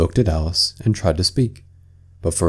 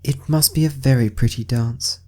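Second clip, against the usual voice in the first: timid; 5 semitones higher than usual. Acted. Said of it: "said Alice timidly".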